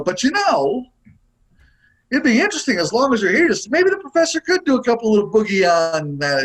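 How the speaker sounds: high, coaxing mock producer voice; imitating the producer pleading, high-pitched. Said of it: "I don't want them to go".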